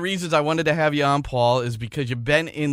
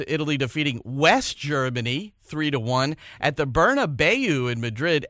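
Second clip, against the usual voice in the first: normal talking voice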